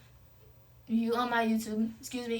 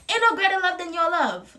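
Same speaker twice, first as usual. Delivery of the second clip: emphatic; 7 semitones higher, 7 dB louder than usual. Real, not acted. Emphatic repeat of the message.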